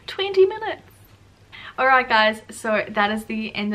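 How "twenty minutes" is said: high, loud burst of disbelief; startled squeal; shocked exclamation. Real, not acted. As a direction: exclaim it in astonishment, pitch leaping up. Shocked at how long she filmed.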